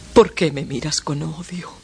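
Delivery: hushed pleading whisper. Acted. Mother pleading with her son in a whisper. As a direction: breathe it out, barely voiced.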